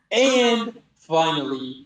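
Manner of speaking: drawn-out, slow build-up with laughter behind it; grand finale. Acted. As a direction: announce it slowly, like a big finish. Mock-grand end to long description.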